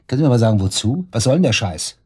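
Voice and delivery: loud, outraged demand; angry shout. Acted. Suspect yells at the detective over the false accusation, furious shout. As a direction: blast the question back at her.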